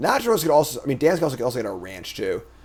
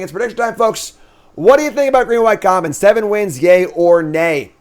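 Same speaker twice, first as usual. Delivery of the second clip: hype announcer; 7 semitones higher, 6 dB louder than usual. Real, not acted. Hyping chat for predictions.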